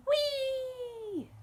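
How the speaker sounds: usual speaking voice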